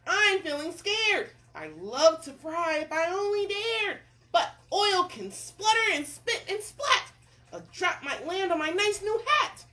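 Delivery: sustained high cartoon worry; scared cook. Cook dreads spitting oil, hammed-up fright. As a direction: fret loudly with hammed-up fear.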